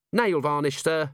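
high-voiced, prompt answer to a superior; eager junior officer. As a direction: answer up at once, high and matter-of-fact. Cheery answers her commander.

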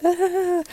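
high, soft, drawn-out awkward ah. Retold or imitated. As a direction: imitate an awkward little filler noise. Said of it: "awkwardly like".

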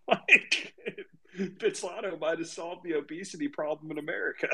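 amused, high-pitched laughing delivery; words squeezed out through laughter. Real, not acted. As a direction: crack up while delivering the punchline. Cracking up at retold joke.

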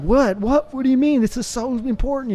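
high-pitched, incredulous retold objection; bewildered protest. Guest replays his freaked-out reply to his buddy, high baffled protest.

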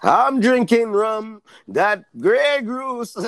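slow, drawn-out mock Dracula accent; botched Jamaican accent that comes out as Count Dracula. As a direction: stretch the words in a hammy Count Dracula accent. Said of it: "he sounds like Count Dracula".

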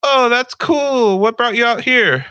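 slow, high, loud mock barista; high drawn-out girly voice. Retelling the barista's interest, loud and high mock voice. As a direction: stretch it out sweet and high. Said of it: "She's like".

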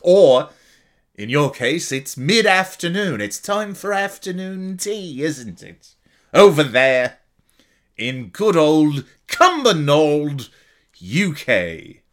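hammed-up British accent for the UK fans; bad British accent. Podcaster teasing Cumbernauld fans in a bad British accent. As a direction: put on a terrible British voice. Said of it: "tried to do an accent".

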